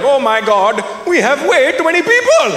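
put-on voice climbing high; hammed-up dismay over an overcount; mock alarm. Indians doing inventory find a surplus, mock alarm. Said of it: "like we were doing inventory".

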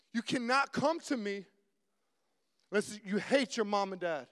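loud, emphatic preacher's quote; forceful declaration. Acted. Jesus challenging the crowd following him, loud and forceful. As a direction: drive each demand home with loud, separated phrases.

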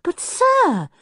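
startled. A narrator's reading. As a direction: pitch jumps up in startled protest. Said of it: "very startled".

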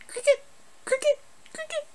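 squeaky high cricket chirp. Retold or imitated. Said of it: "there's crickets going".

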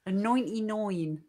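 slow rural Essex, nine as noin; broad Suffolk border accent. Pretend. Repeats the order in rural Essex accent. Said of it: "What accent is that?".

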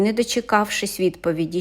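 ordinary delivery

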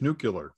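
"nuclear" said as NOO-kyuh-ler; George Bush's mispronunciation. Retold or imitated. Co-host comparing it to Bush, mispronounced "nuclear". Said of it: "George Bush with his nuclear".

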